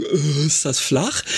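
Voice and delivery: slow, doubting question; doubtful. Retold or imitated. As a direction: sound unconvinced, slowly. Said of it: "Die einen sagen".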